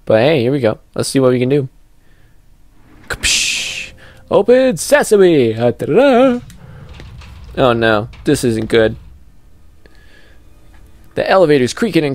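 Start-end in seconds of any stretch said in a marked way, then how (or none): 3.1-6.4 slow, high, grand spell-casting call; mock magic incantation. Gamer opening the elevator door, hammed-up magician incantation. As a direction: proclaim it like a stage magician.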